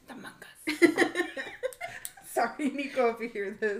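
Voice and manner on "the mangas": loud, high, drawn-out mock French accent; silly French accent. Imitating brother-in-law to friends, silly French accent. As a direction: tease with an exaggerated French accent, laughing. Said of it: "with a stupid little French accent".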